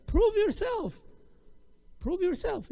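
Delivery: high, insistent exhortation, loud then easing; forceful urging. Real, not acted. Teacher urging sisters who accept criticism, forceful urging. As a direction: call it out as a challenge.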